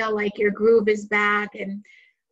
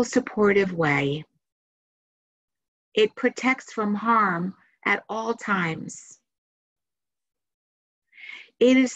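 guided meditation. Acted. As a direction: guide listeners into relaxation, unhurried phrase by phrase.

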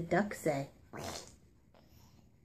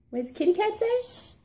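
much higher, singsong "What does the kitty cat say?"; high singsong baby voice; 4 dB quieter than usual. Shown as a demonstration. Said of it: "repeated question to toddler".

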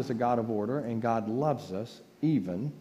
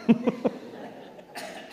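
a high breathy giggle; high airy laugh; 10 semitones higher than usual. Real, not acted. To the congregation about listening in the hall, a high breathy giggle.

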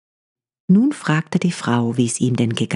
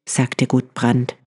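usual speaking voice

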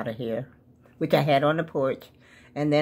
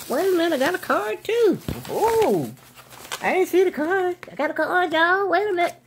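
excited; 11 semitones higher than usual. Real, not acted. Excited at finding a card.

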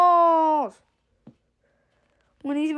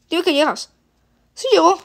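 ordinary talk, nothing marked

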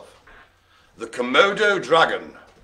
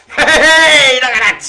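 laughing, high and loud hey; excited laughing cheer; 10 semitones higher, 13 dB louder, slower than usual. Real, not acted. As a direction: whoop with delight at the toy.

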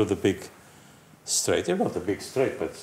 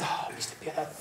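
imitating the Japanese staff; 4 semitones higher, 12 dB quieter, much faster than usual. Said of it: "the Japanese came and said".

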